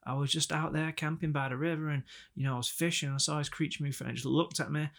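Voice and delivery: lightly put-on Southern accent; lightly voiced Southern Bigfoot witness; mock accent. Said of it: "And we go".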